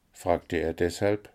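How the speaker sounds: usual speaking voice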